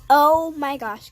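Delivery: loud amazed oh my gosh; surprised exclamation. Real, not acted. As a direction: gasp it out, genuinely shocked. Surprise at two storms forming.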